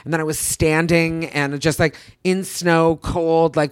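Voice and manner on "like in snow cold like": ordinary talk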